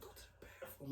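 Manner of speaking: quick, hushed retold whisper; low, soft imitation of himself whispering. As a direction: say it fast and under your voice. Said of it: "I don't know why I'm whispering".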